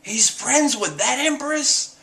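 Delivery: high, drawn-out incredulous cry; imitating a fiery, scandalized outburst; mock outrage. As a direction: burst out in jealous disbelief. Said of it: "he bursts into flames".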